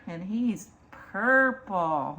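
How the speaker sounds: ordinary delivery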